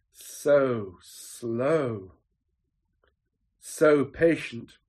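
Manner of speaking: very slow, stretched-out words; drawn-out slowness. Shown as a demonstration. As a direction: draw each word out to sound slow. Dwelling on God's slowness.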